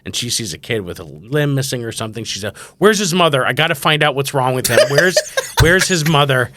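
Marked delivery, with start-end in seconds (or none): 2.8-6.5 raised, higher voice with laughter; demanding child. Retold or imitated. Daughter seeing a kid at the clinic, loudly demanding.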